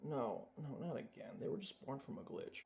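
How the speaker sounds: whispered no, no, not again, a sinking aside; hushed dismay. Acted. Bear whispers his dismay at the glitch, soft and breathy. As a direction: breathe it out, deflated, barely voiced.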